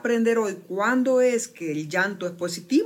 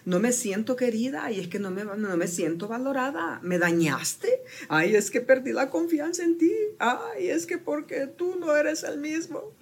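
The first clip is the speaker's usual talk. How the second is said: higher, tearful imitation of a hurt complainer; mock frustrated crying; 7 semitones higher than usual. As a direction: whimper the grievances with a sob. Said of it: "Se llora por frustración".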